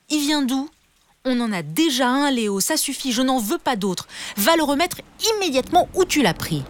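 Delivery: high-pitched, indignant scolding; cross mum laying down the law; angry mother. A narrator's reading. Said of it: "était vraiment très en colère".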